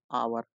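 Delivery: आवर begun on a vowel, the h left silent. Shown as a demonstration. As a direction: show आवर as a word with no h sound. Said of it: "इसको आवर पढ़ते हैं".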